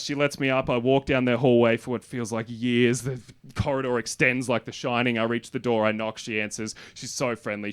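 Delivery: ordinary talk, nothing marked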